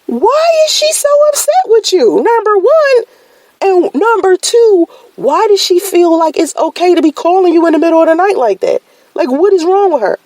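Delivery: very high, disbelieving questions; baffled outrage, pitched far up; high-pitched incredulous rant; exasperated. Retold or imitated. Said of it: "And I'm like".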